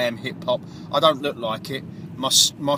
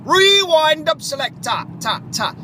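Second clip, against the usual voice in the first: DJ rewind call; 12 semitones higher, 5 dB louder than usual. Pretend. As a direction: call the rewind like a sound-system DJ. DJ calls for a rewind.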